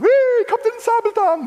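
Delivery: put-on excited toddler. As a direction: play an excited small child shouting his cartoon hero. Voicing excited toddler son.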